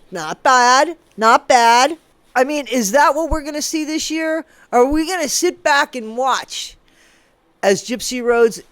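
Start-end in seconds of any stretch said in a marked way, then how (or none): none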